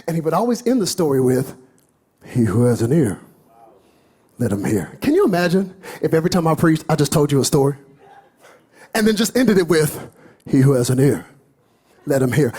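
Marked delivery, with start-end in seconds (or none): 2.3-5.0 solemn Jesus quote. Acted. Quoting Jesus' closing line. 10.5-12.5 solemn Jesus quote. Acted. Quoting Jesus' closing line.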